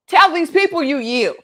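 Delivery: high, forceful order; acted demand. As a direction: command a beaten foe publicly. Acting out victor's demand to yield.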